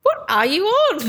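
high, disbelieving retort; what are you on, bemused; incredulous. Retold or imitated. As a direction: toss it back in mock disbelief. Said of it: "I'm like".

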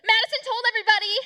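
high, loud, fast tattling yell; imitating classroom blurt. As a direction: mimic a kid blurting out a secret at full volume. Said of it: "And Gib goes".

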